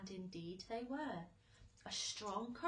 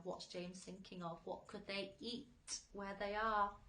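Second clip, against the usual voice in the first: usual speaking voice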